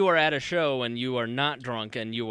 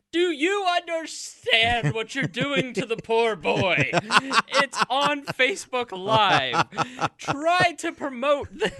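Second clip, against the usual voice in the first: high, slow, exasperated complaint; exasperated Chick; 11 semitones higher, 4 dB louder, much slower than usual. Retold or imitated. Host mocks Chick's plea to promote Facebook, exasperated. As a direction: play him fed up and pleading, slow and high. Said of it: "when Chick goes".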